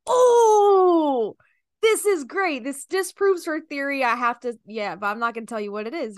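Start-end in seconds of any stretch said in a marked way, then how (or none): 0.1-4.0 loud, high triumphant shout; gleeful shout. Retold or imitated. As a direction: crow it out with glee. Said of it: "I was like".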